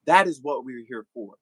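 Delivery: ordinary delivery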